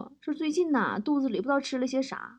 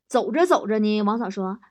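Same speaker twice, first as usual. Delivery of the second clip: ordinary delivery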